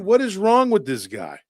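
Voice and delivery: ordinary talk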